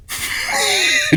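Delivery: a sudden high squeal of a laugh; high burst of laughter. Real, not acted. Laughing at the blunt verdict.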